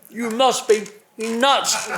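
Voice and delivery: imitating an incredulous boss on the phone, drawn out; mock exasperated boss. Boss Ron scolding driver over factory visit, mock exasperation.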